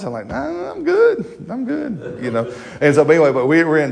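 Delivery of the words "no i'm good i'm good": much higher, slower mock-polite no thanks; squeaky refusal. Husband turning down his wife's massage in a high squeak.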